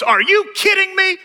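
high, loud incredulous yelp; hammed-up stunned yelp; mock disbelief. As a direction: play the colleague's shocked disbelief. Pastor imitating colleague's shocked reaction.

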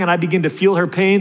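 ordinary talk, nothing marked

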